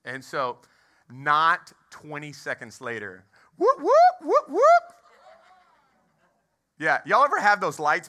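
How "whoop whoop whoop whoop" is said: high, loud whooping siren noise; imitated police siren. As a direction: mimic a cop siren. Police lights pulling him over.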